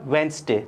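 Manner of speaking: वेडनेसडे as "wens-day", silent d; two-syllable वेडनेसडे with falling tune; correct pronunciation. Shown as a demonstration. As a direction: drop the first d in वेडनेसडे. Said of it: "लेकिन इसको क्या बोलेंगे हम?".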